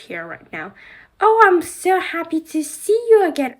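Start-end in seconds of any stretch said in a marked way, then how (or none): 1.2-3.6 high, loud, sing-song greeting; high gushing welcome; Madame Morable voice. Pretend. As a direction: play the grand headmistress gushing hello. Doll play, Madame Morable greets.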